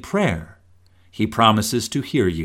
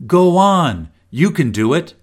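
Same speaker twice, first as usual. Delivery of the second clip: cheering, louder and higher; encouraging; 5 semitones higher, 4 dB louder than usual. Shown as a demonstration. Parent cheering a child learning to ride, encouraging. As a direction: pump them up like a coach. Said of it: "at bat in baseball".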